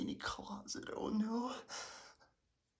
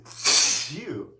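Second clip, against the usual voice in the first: sneeze; 4 semitones lower, 12 dB louder than usual. Acted. One more sneeze.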